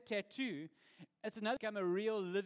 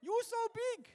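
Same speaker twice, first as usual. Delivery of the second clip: imitating elderly Chinese tourists; 14 semitones higher than usual. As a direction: marvel up at the tall guide. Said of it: "every one of them".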